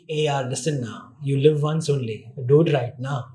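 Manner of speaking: casual Bombay buddy giving advice; Mumbaiya accent. Shown as a demonstration. To the host, the line done in a Mumbaiya accent. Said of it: "The Indian one would be".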